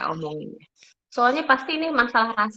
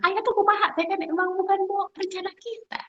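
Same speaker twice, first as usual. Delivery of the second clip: much higher-pitched mock scolding; mock angry complaint; 11 semitones higher than usual. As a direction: imitate a panicked, cross wife without raising volume. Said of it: "saya marah-marah".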